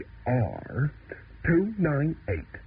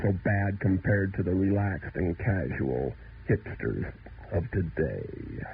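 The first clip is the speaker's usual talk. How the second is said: ordinary delivery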